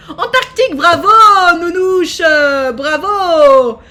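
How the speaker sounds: bravo traîné, bien plus fort; félicite à pleine voix; bravo enthousiaste. Real, not acted. Félicite la bonne réponse. (crie bravo à Nounouche, ravie)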